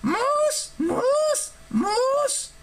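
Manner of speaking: very high squeaky creature, relishing each repeat; savoring the word. A narrator's reading. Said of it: "savoring the word".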